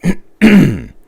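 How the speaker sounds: loud throat clear. Real, not acted. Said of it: "I've got a frog in my throat".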